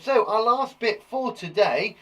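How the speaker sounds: slow build to a loud high English; drawn-out build-up. Acted. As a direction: stretch it out then burst loud. Builds up to the topic.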